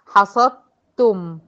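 very slow, loud حصدتم, ص straight onto a doubled ت; slow emphatic recitation; recited merge. Shown as a demonstration. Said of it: "من الصاد على الت المشددة".

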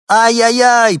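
very high, loud sustained wail; a desperate little dog's lament; wailing cry. A narrator's reading. Small dog on the balcony wails loudly from above. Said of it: "послышалось откуда-то сверху".

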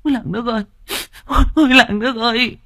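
high, quivering wail. Acted. Fat butcher woman runs up, crying to the village. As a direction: cry out to the whole village in grief. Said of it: "mếu máo".

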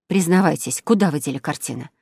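ordinary delivery

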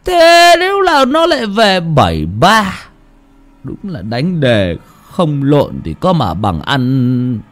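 put-on slurred, slow drunk grumble; drunken mumbling. A narrator's reading. Drunk man grumbling home after drinking, slurred. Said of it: "lèm bèm trong cơn say".

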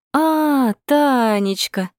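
high, loud, drawn-out joyful greeting; gushing delighted cry; joyful exclamation. A narrator's reading. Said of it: "радостно провозгласил Кудряшов".

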